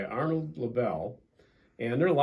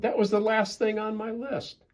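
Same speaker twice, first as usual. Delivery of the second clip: excited Toad; 11 semitones higher than usual. Acted. Toad excited after shouting.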